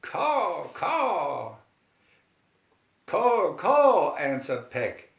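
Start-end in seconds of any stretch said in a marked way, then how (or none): 0.0-1.6 high crow caws, a little louder; crows calling. A narrator's reading. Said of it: "They called". 3.1-4.2 loud, high excited caws; excited crow caw. A narrator's reading. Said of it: "excitedly hopping up and down".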